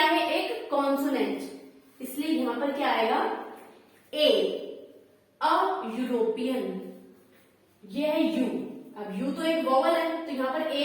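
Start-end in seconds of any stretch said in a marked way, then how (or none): 4.1-6.6 अ European said slowly, the first sound a clear य; teacher slowly saying अ European; slow demo word. Shown as a demonstration. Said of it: "बोल कर देखो".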